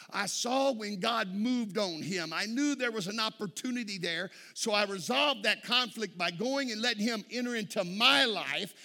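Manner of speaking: fired-up altar-call preaching; full-force preaching. Acted. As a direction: let the sermon climb and ring out.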